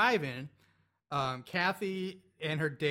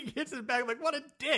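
higher, laughing disbelief; laughing outrage; 10 semitones higher than usual. Real, not acted. Host mocking the cheap date, laughing. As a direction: scoff at him through laughter.

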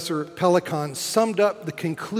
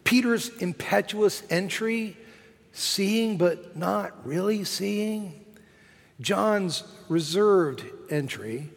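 normal talking voice

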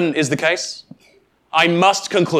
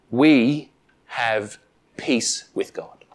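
soft and slow, lower and quieter; slow tender assurance; 5 semitones lower, 4 dB quieter, much slower than usual. Acted. Preacher quoting promise to church, slow and soft. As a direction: let it settle gently.

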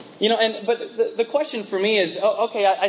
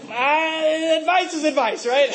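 dismissive; 6 semitones higher, 3 dB louder, slower than usual. Retold or imitated. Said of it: "you're like".

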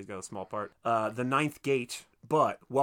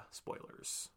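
whispered spoiler warning; mock-secret whisper; hushed aside; 17 dB quieter than usual. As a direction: slip it in under your breath. Mock-secretive aside about a film reveal.

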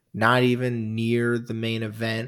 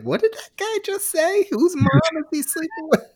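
high, confused voice of the wife; baffled wife calling from the kitchen; Carol puzzled. Retold or imitated. Wife in the kitchen asks about the promo, high and puzzled. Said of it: "She's like".

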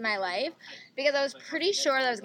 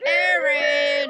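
loud, high frightened yell; panicked shout; 6 semitones higher, 8 dB louder than usual. Retold or imitated. Friends hiding from cadet raid, loud scared shout. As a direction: cry out in mock terror. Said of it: "We're like".

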